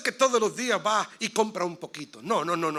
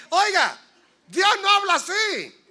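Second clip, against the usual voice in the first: loud, higher, slow, every word weighted; loud rebuke; 10 semitones higher, 9 dB louder than usual. Real, not acted. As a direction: call it out slowly and firmly. Preacher rebukes the practice.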